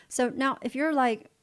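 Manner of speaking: ordinary talk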